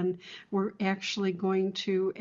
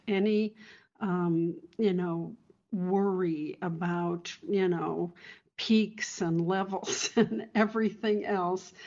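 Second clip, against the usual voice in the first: ordinary delivery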